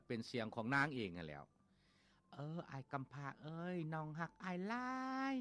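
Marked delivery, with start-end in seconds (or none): 2.2-5.4 put-on lightly higher sweet girl's voice; the flute voicing a shy girl's confession, lightly raised; flute speaking like a woman. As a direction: let the flute call out her love, a little higher. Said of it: "เป็นเสียงเว้าคนเด้".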